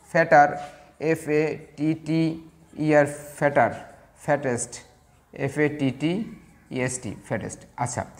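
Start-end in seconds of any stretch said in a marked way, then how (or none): 1.0-3.1 very slow, separated letters; spelling out. Shown as a demonstration. Teacher spelling fatter for the class, slow spelling out. 5.3-7.2 slow, softer, letter by letter; spelling out. Shown as a demonstration. Teacher spelling fattest for the class, slow spelling out.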